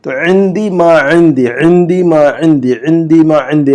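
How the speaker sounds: عندی ما عندی with a throaty عین; drilled repetition. Shown as a demonstration. Teacher drills the phrase for students to repeat. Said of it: "ایسے دس مرتبہ رٹیے".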